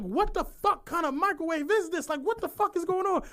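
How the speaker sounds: high-pitched retold exasperation; pissed off. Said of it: "So I'm pissed".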